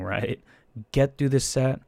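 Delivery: normal talking voice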